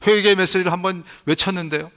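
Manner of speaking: ordinary delivery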